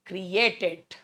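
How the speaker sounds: usual speaking voice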